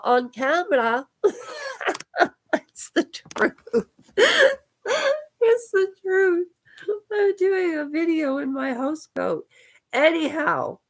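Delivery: tearful voice breaking, high and strained; fighting back real tears; crying. Real, not acted. To her viewers about her dog, crying through the words. Breaks down while telling story.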